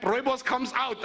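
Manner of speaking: normal talking voice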